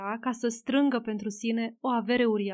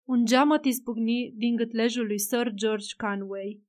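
usual speaking voice

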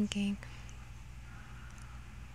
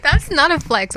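loud; 7 semitones higher, 11 dB louder than usual. Real, not acted. Loud self-correction.

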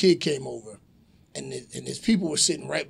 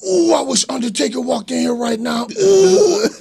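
high, loud indignant onlooker; outraged girl; 9 semitones higher, 9 dB louder than usual. Retold or imitated. Girl at next table wishing payback, loud outrage. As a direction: seethe loudly, pitched up, like an offended girl. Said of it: "one of the girls at the table".

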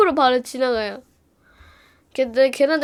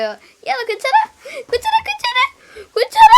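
rising higher and higher, ending in a loud squeal; worried friends; 5 dB louder than usual. Retold or imitated. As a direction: pile on the worry, each 괜찮아 higher. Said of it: "하면서 뭐 물어봐요".

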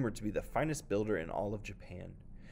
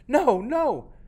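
high, loud desperate plea; anguished pleading; 12 dB louder than usual. Acted. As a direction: beg in horror. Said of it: "cried out in anguish".

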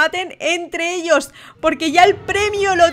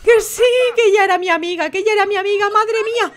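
high, rushed outburst; frantic exclaiming; 6 semitones higher than usual. Real, not acted. Host protests about her friend, frantic exclaiming. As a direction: gabble it high, building to a cry.

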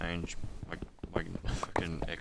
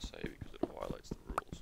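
whisper; 12 semitones lower, 7 dB quieter than usual. Real, not acted. Joking aside about banned word.

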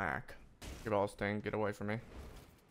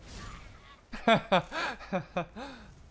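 1 high laugh; 11 semitones higher than usual. Real, not acted. Laughs at own death.

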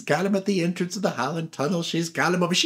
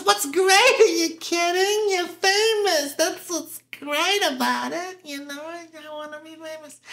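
Gladys Glover voice; 12 semitones higher than usual. Retold or imitated. Gladys defending fame to the filmmaker, high incredulous voice. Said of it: "She goes".

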